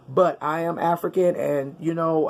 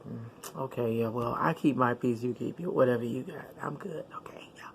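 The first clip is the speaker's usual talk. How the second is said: hushed whisper, much softer and lower; quiet aside; 4 semitones lower, 10 dB quieter than usual. Retold or imitated. Shrugging off petty people, a whispered aside. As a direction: say it under your breath, keeping the peace. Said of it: "I just look and I'm like".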